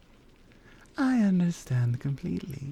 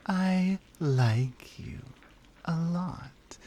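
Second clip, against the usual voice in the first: very clearly; much slower than usual. Acted. Confessing to the listener, slowly and clearly. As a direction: spell out each word so there's no mistake. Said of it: "I'm going to say this very clearly".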